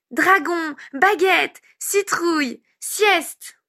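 put-on very high little-boy voice, word by word; thrilled small kid playing a word game; delighted child. A narrator's reading. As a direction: keep firing off words in childish glee. Child keeps dictating words happily.

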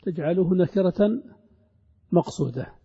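normal talking voice

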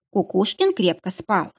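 plain reading voice

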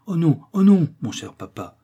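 vif et haut, puis retombe sur mon cher papa; s'écrier avec empressement d'enfant; vivement. A narrator's reading. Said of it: "dit vivement le garçonnet". (le garçonnet répond vivement à son papa)

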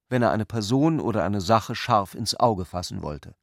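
ordinary talk, nothing marked

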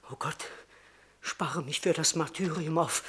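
a hushed, scared prayer; frightened whisper. Acted. Terrified man whispering a prayer to God. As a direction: breathe it out to God in dread.

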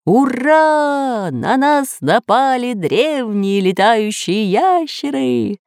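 high, drawn-out cheering; jubilant cry. A narrator's reading. Professor rejoices at the flying lizards, high jubilant cry. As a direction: exult with long, stretched words. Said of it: "ликовал профессор".

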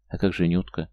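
usual speaking voice

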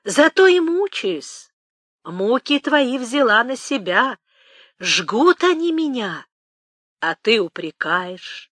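slow, high-pitched keening; old woman's drawn-out lament. Acted. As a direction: wail it slowly, settling down into reproach. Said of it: "запричитала старуха".

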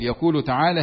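normal talking voice

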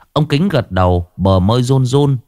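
plain reading voice

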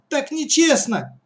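high, drawn-out protest; outraged childish whine; indignant. Acted. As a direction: complain slowly in a high girl's voice. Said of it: "возмутилась Катя".

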